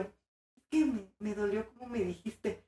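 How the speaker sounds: mild mock hurt, soft and halting; softly imitated tearful complaint; retold sniffly hurt. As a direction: play the wounded girlfriend, quietly. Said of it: "yo así con mi moquita".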